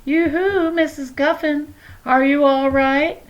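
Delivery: high, sing-song falsetto calling out, slowing on the question; a fussy neighbor lady trilling through the door; neighbor woman calling. A narrator's reading. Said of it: "A voice outside called".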